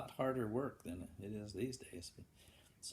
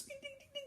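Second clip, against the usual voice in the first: very high soft dings; hammer dings; 10 dB quieter, much faster than usual. Acted. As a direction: tap out tiny hammer dings. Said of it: "Grandpa Cochran goes".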